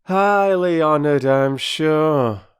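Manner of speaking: loud, slow, sarcastic porter; cold sarcasm. Acted. Perks snubbing the children at his door, cold and sarcastic. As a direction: greet them with frosty mock politeness. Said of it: "very coldly received by Perks".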